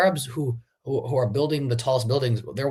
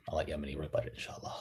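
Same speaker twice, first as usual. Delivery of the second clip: barely whispered aside; soft whispered sign-off; 10 semitones lower, 14 dB quieter, much faster than usual. Real, not acted. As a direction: drop to a whisper, handing over. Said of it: "trailing off to a whisper".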